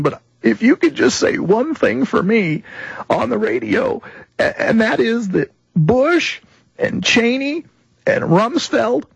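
put-on an old man near tears, furious at traitors; indignant old veteran. Old veteran begging the host to speak out, voice breaking. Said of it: "His, his voice is breaking".

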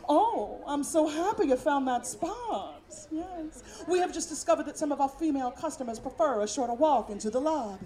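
syrupy hotel hospitality voice; joyful Southern concierge. Retold or imitated. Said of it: "woman looked at me with a genuine Southern smile".

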